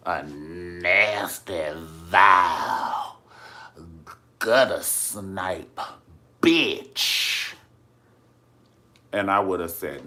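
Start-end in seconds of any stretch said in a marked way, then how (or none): 0.1-7.5 slow, loud, drawn-out Madea tirade; Madea style. Retold or imitated. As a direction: hammer every insult slowly like Madea. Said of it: "good old Madea style".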